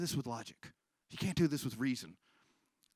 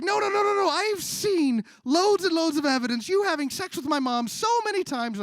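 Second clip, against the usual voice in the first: shrieking frantic insistence; 9 dB louder than usual. Acted. Son at breaking point.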